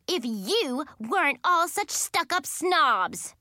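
high, drawn-out angry insult; shouting. A narrator's reading. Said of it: "Cheyenne shouted at Brittany".

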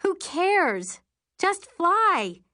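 high, loud carefree holler; exhilarated shout. A narrator's reading. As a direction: shout back over the rushing wind. Passenger shouting back over the wind.

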